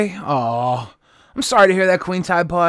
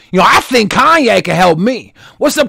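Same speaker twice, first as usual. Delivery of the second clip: normal talking voice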